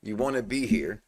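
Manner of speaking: soft, low, knowing invitation; hushed, understated persuasion; low-key Ronnie impression. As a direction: act out a quiet, assured nudge. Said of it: "he don't talk like that".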